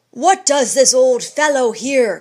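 loud, high angry challenge; fierce demand. Acted. Randolph scorning the old man, loud and fierce. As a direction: spit it at the old intruder. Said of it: "cried Edward Randolph fiercely".

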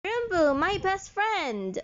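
high-pitched, drawn-out greeting of a best friend; slow, high, overjoyed hello; gleeful greeting. Acted. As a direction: hail a dear friend with delight, stretching the last words.

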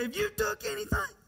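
very high, insistent fatherly question; mock exasperated repeat. Father repeats medicine question to daughter, mock exasperated. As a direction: press the question with rising, hammed-up impatience.

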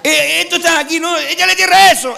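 high, loud mocking yell; loud taunt. Re-enacting the taunt at the old man, high and loud. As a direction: taunt him, pointing it out.

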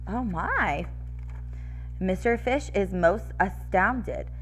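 usual speaking voice

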